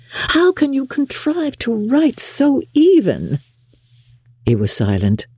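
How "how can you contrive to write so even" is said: very high, slow lady admirer; Miss Bingley, gushing. A narrator's reading. Lady admiring his handwriting, gushing. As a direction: coo admiringly, high and drawn out.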